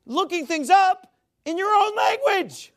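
high, loud, drawn-out insistence; exasperated shout. Real, not acted. Preacher driving home the purpose of dictionaries, exasperated and loud. Exasperated rant hammering the point.

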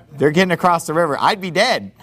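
ordinary talk, nothing marked

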